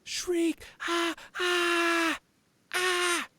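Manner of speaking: high, loud wild shrieks; shrieking. Pretend. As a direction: screech like a feral bandit. Shrieking like the bandits again.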